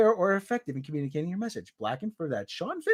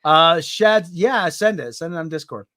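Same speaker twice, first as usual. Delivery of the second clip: usual speaking voice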